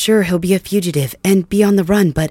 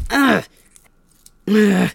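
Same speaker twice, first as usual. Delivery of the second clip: sharp, higher grunts of pain; 5 semitones higher, 5 dB louder than usual. Acted. Prisoner being whipped, pained grunts. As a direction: cry out under the lash.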